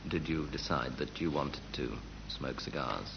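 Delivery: normal talking voice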